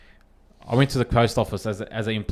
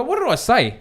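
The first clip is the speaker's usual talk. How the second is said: high-pitched, louder comeback; challenging retort; 11 semitones higher, 5 dB louder than usual. Retold or imitated. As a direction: throw the challenge back at her, voice raised. His retort in retold quarrel.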